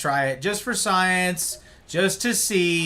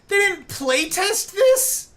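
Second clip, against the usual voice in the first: incredulous; 13 semitones higher than usual. Real, not acted. Incredulous at untested game settings.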